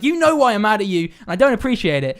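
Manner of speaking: high, loud mock scolding; mad driver. Taxi driver snaps back at baffled passenger, mock mad. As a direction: imitate a driver snapping back, indignant. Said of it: "why you're mad at me".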